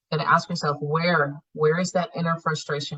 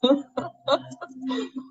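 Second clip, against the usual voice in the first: laugh; 7 semitones higher than usual. Real, not acted. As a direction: let out a short amused laugh. Laughs after prompting reflection.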